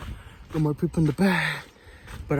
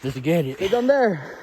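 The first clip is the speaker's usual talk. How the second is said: ordinary talk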